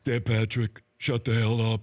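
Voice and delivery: put-on low player's voice, played for laughs; Shaquille O'Neal impersonation. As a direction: imitate a basketball star telling off the host. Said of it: "my impersonation with you".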